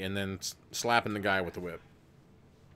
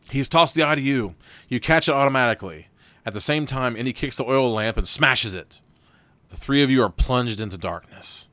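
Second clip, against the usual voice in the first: louder, driving read-aloud of the adventure; lively game master narration; story narration; 2 semitones higher, 7 dB louder than usual. Acted. Reads the gamebook aloud.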